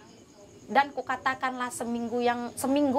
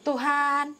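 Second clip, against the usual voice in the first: ordinary talk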